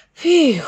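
high loud relieved phew; relieved sigh. A narrator's reading. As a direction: let out a big relieved phew. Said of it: "let out a sigh".

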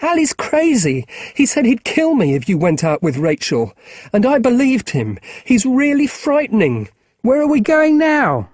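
put-on high, frightened boy's voice; a scared kid blurting it all out; young boy Marcus. Boy Marcus telling Will about Ally, high and scared.

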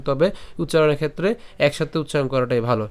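normal talking voice

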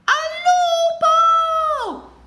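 long, high alarm call; stretched cry for help; cry of wolf. A narrator's reading. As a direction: yell it as if the wolf is really there. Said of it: "gridò Luigi".

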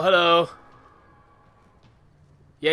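ordinary talk, nothing marked

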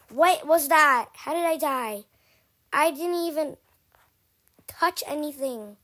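loud baffled complaint; indignant shout. Real, not acted. Kid dying without touching anything, loud indignant shout. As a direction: protest the unfair death. Died unexpectedly.

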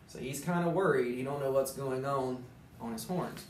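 plain reading voice

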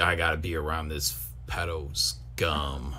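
low, slow, soft grumble; weary mock disgust, low and drawn out; disgusted onlooker. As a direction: grumble it slowly under the breath. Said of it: "Yeah, he's like".

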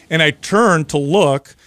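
ordinary delivery

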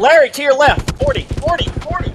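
high urgent callout; urgent warning. Real, not acted. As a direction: warn your buddy fast. Warning teammate of enemy.

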